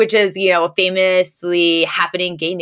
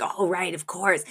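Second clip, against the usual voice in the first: sheepish aside; 8 dB quieter than usual. Retold or imitated. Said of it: "Val kind of has a".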